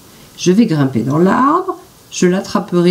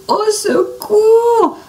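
much higher, slow drawn-out cry for help; fake wounded moaning; much slower than usual. A narrator's reading. Jean-Claude faking injury to attract the bison. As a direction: wail as if hurt, to lure the bison. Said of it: "se met à gémir comme s'il était blessé".

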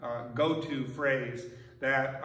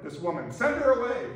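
annoyed disciples; 6 semitones higher, 5 dB louder than usual. Retold or imitated. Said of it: "And they're like".

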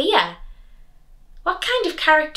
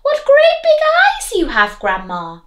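put-on high, loud little girl voice; 5 dB louder than usual. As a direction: sing it out like a curious little girl.

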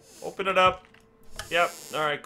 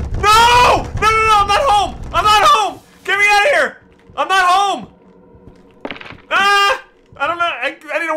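high, loud, terrified screaming; panicked screaming; 10 dB louder than usual. Real, not acted. Panics as a ghost appears.

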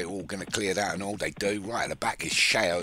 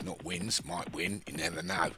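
ordinary talk, nothing marked

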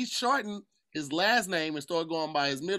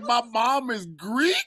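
loud, higher, drawn-out mocking echo; mocking quote; 5 semitones higher, 7 dB louder, much slower than usual. Castmate repeating another's claim to the group, mocking it. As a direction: stretch it out in amused disbelief.

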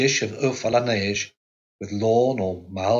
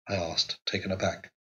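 plain reading voice

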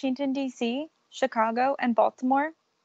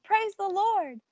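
narrated yell; 9 semitones higher, slower than usual. A narrator's reading. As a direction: sing out like mama in church. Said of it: "My mama yelled".

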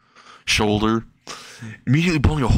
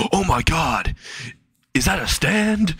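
mock astonishment; 7 semitones higher, slower than usual. Everyone reacts to floating girl.

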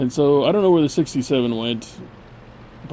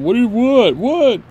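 high, loud baffled what; surprised; 10 semitones higher, 6 dB louder than usual. Real, not acted. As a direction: blurt out a startled what. Reacting to chips.

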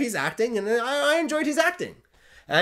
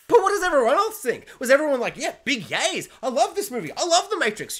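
much higher, sing-song fake enthusiasm; mock gushing fan; 8 semitones higher, 3 dB louder than usual. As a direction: parody a starry-eyed fan. Mocking fans who liked it.

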